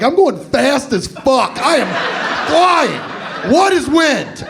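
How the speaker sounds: hammed-up flying high; stoned thrill yell. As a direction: ride the speed like a giddy stoner. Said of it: "and I was like".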